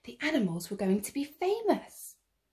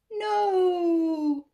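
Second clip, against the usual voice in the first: high loud cry of dismay; alarmed cry; 9 semitones higher, 4 dB louder than usual. Acted. Man falling backwards into the sea, alarmed cry.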